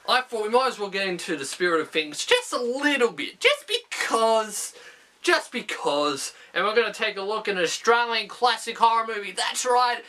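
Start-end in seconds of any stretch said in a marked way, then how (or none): 3.4-4.7 a drawn-out, playful "just because". Pretend. Repeated catchphrase, second take lower. 5.2-6.3 "just because" dropped low and slow; low slow echo. Pretend. Repeated catchphrase, echoed deeper.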